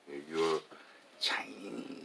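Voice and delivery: normal talking voice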